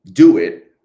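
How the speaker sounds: loud, punchy self-command; short emphatic burst. Retold or imitated. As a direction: hit it hard, like a coach. Emphatic self pep talk.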